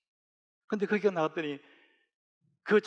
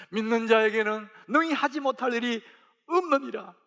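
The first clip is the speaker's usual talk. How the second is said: quoted promise pushed higher and louder; Jesus' words proclaimed louder and higher; louder, rising conviction; 7 semitones higher, 4 dB louder than usual. Retold or imitated. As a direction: preach the promise with rising force. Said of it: "우리들 향한 주님의 마음이 뭐냐?".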